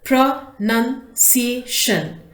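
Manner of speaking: "Pronunciation" slowly as pro, nun, cia, tion; four separate beats on "Pronunciation"; split into syllables. Shown as a demonstration. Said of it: "When we break words into different syllables".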